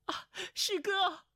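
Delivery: high, drawn-out alarmed call; startled cry. A narrator's reading. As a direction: cry out in shock to someone dear. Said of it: "叫道". (惊慌地拖长高声呼喊)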